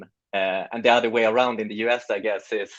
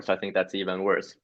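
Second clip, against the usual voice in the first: ordinary delivery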